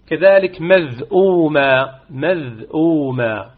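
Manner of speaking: مذءومة said very slowly, short madd; slow recitation. Shown as a demonstration. Teacher models the word for students. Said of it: "إذن هذه أمثلة".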